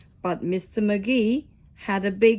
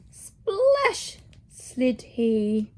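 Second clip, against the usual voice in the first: drawn-out slow delivery; much slower than usual. Acted. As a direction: stretch every word slowly. Slowed splash line.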